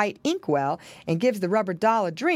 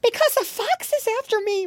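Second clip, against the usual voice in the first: very high little pig voice; high, urgent piggy boy; frightened piglet. A narrator's reading. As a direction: squeal the danger like a small pig. Piglet tells of danger.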